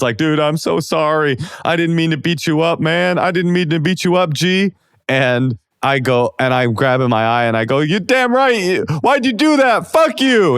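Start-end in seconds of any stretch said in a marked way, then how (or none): 0.2-4.7 high, pleading mock whimper; lightly hammed-up weepy apology; imitated tearful apology. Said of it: "and he's crying now". 7.8-10.6 very high, hammed-up indignant squeal; imitated high-pitched angry protest; mock upset yell. Crying, hurt retort in retelling.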